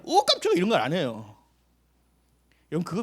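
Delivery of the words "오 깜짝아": much higher, hammed-up fright; playful fake jump scare; mock startle. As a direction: squeal like you've been spooked. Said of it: "깜짝 놀래".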